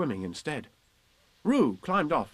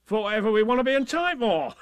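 higher, loud, bouncy voice; boisterous Tigger; 11 semitones higher, 6 dB louder than usual. Acted. Tigger puts off Roo while hurrying on, loud bluster. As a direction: blurt it out with bluster. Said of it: "said Tigger".

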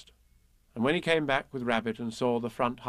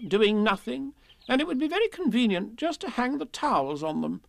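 high, busy rabbit voice; oblivious jolly animal character; chatty, cheerful Rabbit; 10 semitones higher than usual. Acted. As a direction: rattle on breezily, pitched up.

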